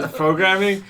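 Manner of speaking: high, loud laughing repeat. Real, not acted. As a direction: crack up while repeating the phrase. Repeats it while cracking up.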